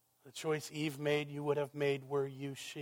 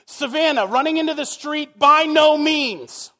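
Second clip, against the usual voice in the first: mock yell; 12 semitones higher, 15 dB louder than usual. Father yelling at daughter Savannah in the street, laughing mock shout. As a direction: yell it at a child darting into the road. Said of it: "Can you imagine me yelling at Savannah".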